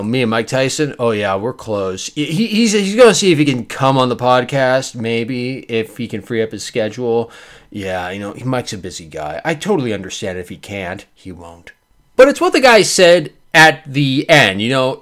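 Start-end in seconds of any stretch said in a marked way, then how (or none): 0.0-11.7 low, quiet name-dropping, trailing away; feigned casual boasting. Acted. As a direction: brag offhandedly, getting lower and softer. Acted casual boasting about celebrity friend.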